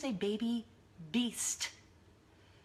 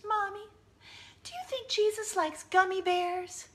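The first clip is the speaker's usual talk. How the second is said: put-on high, small child voice; little girl; 11 semitones higher than usual. Youngest snuggling mom asks about Jesus, childlike. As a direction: pipe it up like a curious kid.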